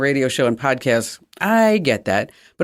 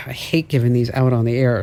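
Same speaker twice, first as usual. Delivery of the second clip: usual speaking voice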